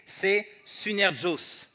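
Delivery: slow, careful "synergos" sounded out as si-ner-gos; Greek word synergos. Shown as a demonstration. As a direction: spell out "synergos" syllable by syllable. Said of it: "Le mot grec de ouvrier".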